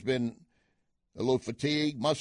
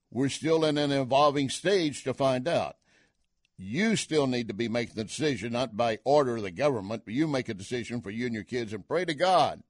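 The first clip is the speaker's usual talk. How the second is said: ordinary talk, nothing marked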